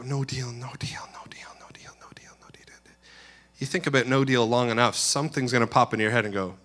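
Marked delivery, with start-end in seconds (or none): 0.0-2.8 anxious muttering trailing off to nothing; fading whispered refusal. Retold or imitated. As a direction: drop from soft voice into a fading whisper. Child self silently refusing, fading away.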